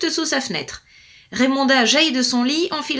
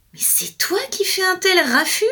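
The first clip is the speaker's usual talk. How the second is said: furious exclamation; 7 semitones higher than usual. Acted. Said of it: "s'écria Raymonda, furieuse".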